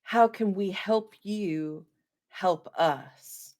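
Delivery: ordinary talk, nothing marked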